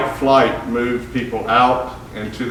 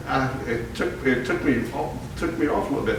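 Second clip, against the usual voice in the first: laughing softly; 7 dB quieter than usual. Real, not acted. Laughing after the punchline setup.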